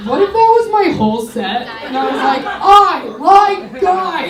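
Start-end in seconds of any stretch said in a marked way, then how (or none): 2.5-4.3 slow, louder, higher i like guys; overselling. Acted. Comic pretending her whole set is one line, overselling. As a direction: insist a bit too hard.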